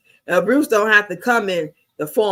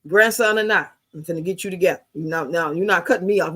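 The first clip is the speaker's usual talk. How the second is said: normal talking voice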